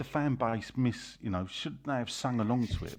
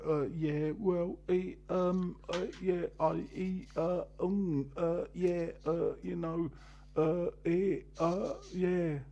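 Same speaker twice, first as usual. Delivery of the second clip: stumbling impression; 6 semitones higher, much slower than usual. Imitating someone's stumbling speech.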